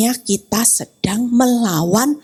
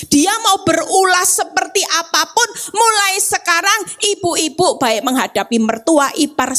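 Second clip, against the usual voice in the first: ordinary talk, nothing marked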